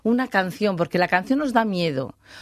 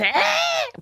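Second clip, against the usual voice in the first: sudden very high bird cry; piercing imitated bird call; bird call imitation. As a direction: mimic a forest bird's call. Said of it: "un ave que hace".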